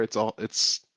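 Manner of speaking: ordinary delivery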